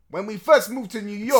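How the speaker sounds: put-on New York Italian accent; thick Italian American accent. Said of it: "Stop it, please".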